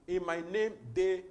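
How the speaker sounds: name rising, then they punched very loud; stressed they. Shown as a demonstration. Preacher correcting the congregation, they shouted loud. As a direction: land hard on they. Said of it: "preacher drilling the verse".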